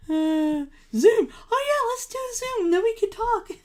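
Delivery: high, hammed-up gushing voice; mock excited voice. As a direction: play a giddy version of yourself. Mocking her own Zoom idea.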